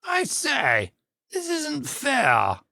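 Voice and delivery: the unicorn complains, high and drawn out; the unicorn crying out. A narrator's reading. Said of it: "cried the unicorn".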